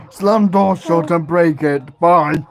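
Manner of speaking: loud grunting caveman. Pretend. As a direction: bellow it like a proud caveman. Caveman talk game.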